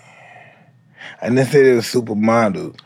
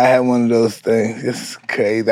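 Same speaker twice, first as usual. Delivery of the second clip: ordinary delivery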